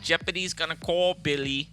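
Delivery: high-pitched put-on New York accent; mock New York accent voice; Rosie Perez. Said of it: "like I was talking to fucking Rosie Perez".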